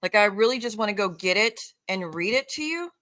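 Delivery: normal talking voice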